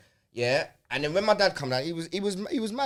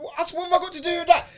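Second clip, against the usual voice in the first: incredulous protest; faster than usual. Retold or imitated. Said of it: "I was like".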